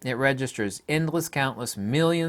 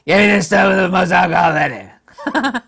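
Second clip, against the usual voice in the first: a laughing, louder put-on voice of a boozer; mock alcoholic bum; 5 semitones higher, 9 dB louder than usual. Vlogger mocks a homeless drunk ordering at the liquor store, laughing put-on voice. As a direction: ham up the homeless drunk asking for the strongest stuff. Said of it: "the alcoholic, homeless guy".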